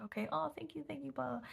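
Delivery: high-pitched, placating mock thanks; acted politeness, pitched well up. Bemused thanks to over-apologetic worker.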